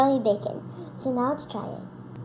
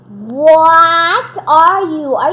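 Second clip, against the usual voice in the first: mock outrage; 10 dB louder, much slower than usual. Real, not acted. Talking to heavy gummy.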